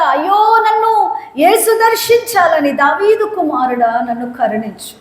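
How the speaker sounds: high pleading beggar; lightly voiced blind beggar; blind man crying out. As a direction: cry for mercy then fade. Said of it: "blind man voiced crying".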